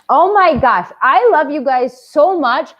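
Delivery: high, loud burst of affection; bursting with love for her viewers; gushing. Real, not acted. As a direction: gush over them with real delight.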